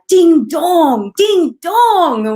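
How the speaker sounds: high, loud British "Ding dong, ding dong" with falling tune; British accent. Retold or imitated. Host quoting the judge's catchphrase, loud British accent. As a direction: shout it like a thrilled British makeup judge. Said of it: "she has this British accent".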